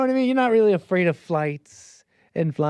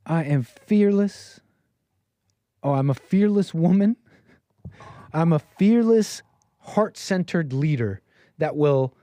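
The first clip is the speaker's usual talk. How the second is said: mock dramatic; 2 semitones higher, slower than usual. Host reading out a celebrity's fearless-leader affirmations, mockingly dramatic. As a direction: declaim each phrase slowly with exaggerated weight. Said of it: "So dramatic".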